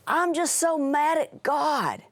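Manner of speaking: put-on high, slow, whiny teen girl voice; upset teen girl. Girl quoted, sobbing.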